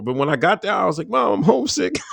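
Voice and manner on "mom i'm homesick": put-on high, whiny little-boy plea; mock homesick kid. Young recruit calling his mom, mock homesick whine.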